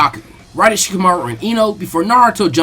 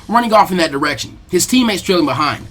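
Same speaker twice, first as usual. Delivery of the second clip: usual speaking voice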